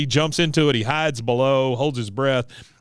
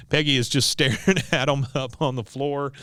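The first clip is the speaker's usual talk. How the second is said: ordinary delivery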